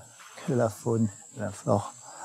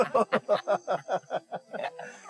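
rire nerveux; 11 semitones higher than usual. Real, not acted. Rit nerveusement après la frayeur.

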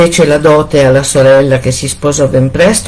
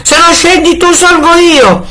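yelling; 14 semitones higher than usual. A narrator's reading. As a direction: bellow up the stairs to scare her. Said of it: "e urlava".

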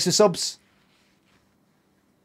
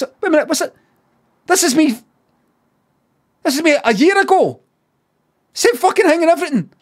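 high-pitched, freaked-out realisation; astonished; 11 semitones higher, faster than usual. Real, not acted. Streamer seeing himself a year ago, astonished. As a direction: cry out in mounting disbelief.

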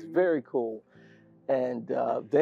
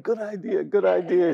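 higher, laughing imitation of everyone agreeing; eager agreement; 7 semitones higher, 8 dB louder than usual. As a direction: mimic a relieved group chiming in. Acts out relieved crowd agreeing, laughing.

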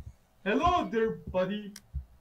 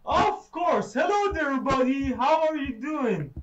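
loud, high sarcastic hello to the monster; exaggerated fake friendliness, shouted high; mock cheerful greeting; 7 semitones higher, 7 dB louder than usual. Real, not acted. Sarcastic greeting to jump scare.

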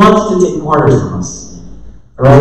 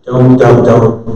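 normal talking voice

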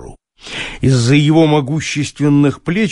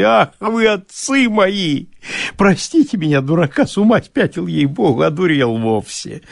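higher, fawning peasant fuss; 3 semitones higher than usual. Acted. Bailiff frets to his master, high and fawning. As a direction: plead and fret like a servile bailiff.

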